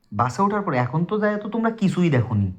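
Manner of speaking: ordinary delivery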